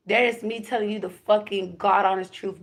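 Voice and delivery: normal talking voice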